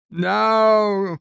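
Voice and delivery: long, high, loud cry of refusal; Quark's yell. Retold or imitated. Quark waking from his nightmare, loud drawn-out yell. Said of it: "Quark is yelling".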